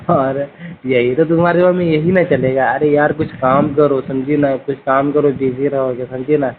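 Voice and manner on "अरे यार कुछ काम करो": ordinary delivery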